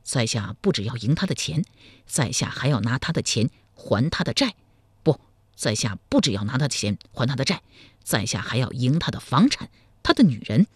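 cocky and sure of himself; self-assured. A narrator's reading. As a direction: hero boasting his plan to the girl. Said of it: "赵匡胤胸有成竹地说".

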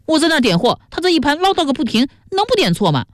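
high-pitched, rushed whining protest; yelping protest. A narrator's reading. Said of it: "孙元嚷起来说".

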